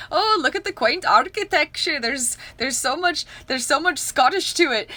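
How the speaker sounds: high, loud, hammed-up Scottish accent; mock Scottish accent. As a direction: gush like a tourist in a bad Scottish accent. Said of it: "but in an accent, we were like".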